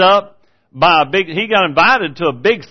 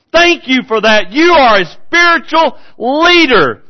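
louder, higher revival preaching; fiery preaching; 7 semitones higher, 5 dB louder than usual. Acted. Preacher praising the prophet before his church, in fiery preaching. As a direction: proclaim it with fire.

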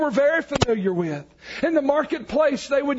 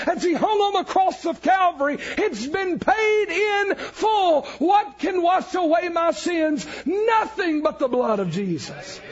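preaching at full force; 5 semitones higher than usual. Acted.

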